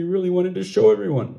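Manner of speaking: ordinary delivery, nothing marked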